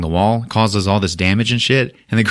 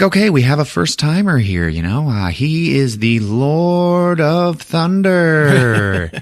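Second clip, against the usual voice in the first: imitating an arena host, higher, drawn out on the title; showman's introduction; 7 semitones higher, slower than usual. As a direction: stretch the fighter's title like a ring announcer. Grandmaster introduces a fighter to arena.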